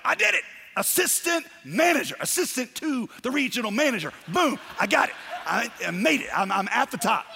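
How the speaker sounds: mock victory shout; triumphant bragging. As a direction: celebrate like you just got promoted. Acting triumphant achiever.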